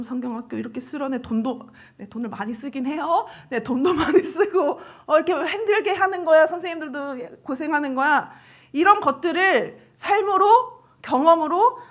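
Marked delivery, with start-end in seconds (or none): none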